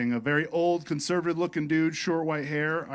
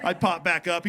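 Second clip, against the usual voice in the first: normal talking voice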